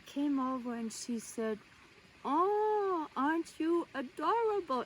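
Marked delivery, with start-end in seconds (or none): 2.2-4.8 put-on high, gushing voice with heavy German accent; Jacqueline. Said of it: "with this very heavy accent".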